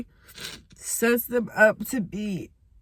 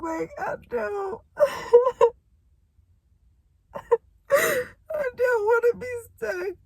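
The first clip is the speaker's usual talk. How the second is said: crying; 9 semitones higher than usual. Real, not acted. Telling the camera she won't stay stuck, crying. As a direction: voice breaking high through tears. Breaking down about feeling stuck.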